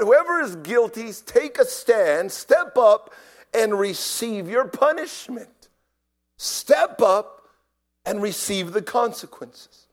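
loud, high guard's command; shouted demand. Retold or imitated. Guard demanding the guilty prisoner step up, shouting. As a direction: imitate a guard shouting orders, measured and forceful. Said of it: "he began to shout".

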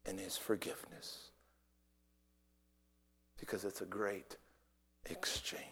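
low, very soft, reverent delivery; hushed preaching. Real, not acted. Preacher explaining the great exchange, hushed. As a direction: drop to a near murmur with long pauses.

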